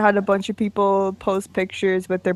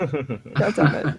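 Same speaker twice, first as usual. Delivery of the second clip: laughing exasperation; 6 semitones lower, 5 dB quieter, much slower than usual. Real, not acted. Frustrated, laughing at own name.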